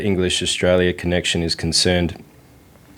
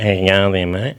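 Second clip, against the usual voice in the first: nasal Aussie. Retold or imitated. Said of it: "Australians that talk through their nose".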